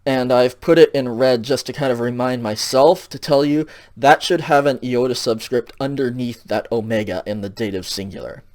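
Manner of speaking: ordinary talk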